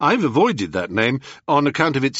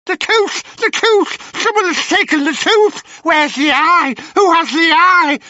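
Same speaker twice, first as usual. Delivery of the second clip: high, loud frantic crone cries; panicking hags; 6 dB louder than usual. Acted. Sisters panicking over the stolen tooth, high frantic crone voices. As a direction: wail like witches robbed.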